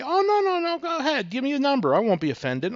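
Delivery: put-on high voice, urging them on; eager insistence. Seller coaxing buyer to name the number, eager insistence.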